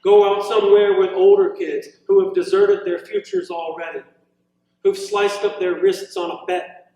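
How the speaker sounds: unhurried, deliberate poem reading with long pauses; poetry reading. Acted. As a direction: savour each line of the poem. Poet reads poem aloud.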